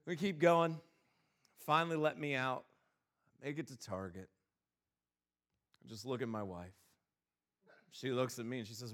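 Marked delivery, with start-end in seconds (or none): none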